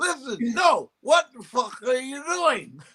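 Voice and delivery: high, odd-sounding cursing; Cosby cursing. Retold or imitated. Comic mimics his old boss cursing off camera, high and odd. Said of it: "it always sounded weird".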